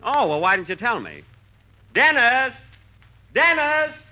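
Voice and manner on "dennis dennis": calling out. Real, not acted. Calling Dennis from another room.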